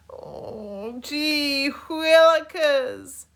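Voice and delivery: slow, high drawn-out groan. A narrator's reading. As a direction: groan in dismay. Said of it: "groaned Hector".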